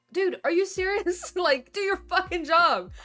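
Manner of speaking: loud high exasperated outburst with a laugh; exasperated yell. Retold or imitated. Retelling a call to customs, yelling at the agent in disbelief. Venting at unhelpful phone agents.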